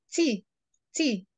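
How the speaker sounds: "T" said alone as "tee", twice; teacher repeating a separate "T"; model end sound. Shown as a demonstration. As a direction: isolate the final "T" as "tee". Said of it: "Repeat the, this, the end, this sound".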